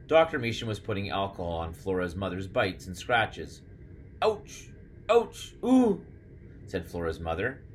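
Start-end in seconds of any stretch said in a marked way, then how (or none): 4.2-6.0 high yelps of pain; acted pain. Mother having alcohol put on her scratches, high pained yelps. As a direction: yelp as the sting hits, getting louder.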